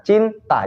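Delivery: "Cinta" sung with a round open vowel. Shown as a demonstration. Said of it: "Vokal kan bulat kan?".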